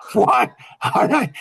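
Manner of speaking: high-pitched, amused "what?"; incredulous reaction. Retold or imitated. Dad reacting to his mother's jab at him, high-pitched incredulity. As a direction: react in high, loud disbelief.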